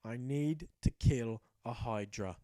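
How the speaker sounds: low, ominous chronicle voice; grave story narration. A narrator's reading. Narrator telling of the hero's last goal, low mock-epic gravity. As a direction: drop low and intone it like an epic.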